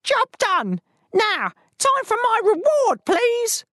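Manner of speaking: high eager bird voice; chirpy demanding crane. Acted. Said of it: "the crane uttered".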